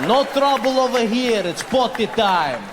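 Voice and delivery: put-on slow, comic Russian accent; put-on Russian accent, words stretched. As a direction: play it as a drawn-out, menacing Russian. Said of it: "speak to myself in a Russian accent".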